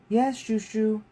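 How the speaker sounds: put-on high, light girl's voice; young girl. Young girl answers her uncle, high and light. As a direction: chirp it like a polite little girl.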